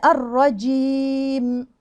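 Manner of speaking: الرجيم drawn out very slowly, jeem closed and meem clear; slow correct recitation. Shown as a demonstration. Teacher slowly modelling الرجيم correctly for students. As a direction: stretch الرجيم, tight jeem, final meem sounded. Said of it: "ما ينفعش أقول الرجيم".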